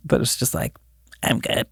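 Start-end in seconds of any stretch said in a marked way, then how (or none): 1.2-1.7 soft, distant spirit-box voice; barely there, like through radio static; faint ghost voice. Retold or imitated. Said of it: "where you can hear a voice".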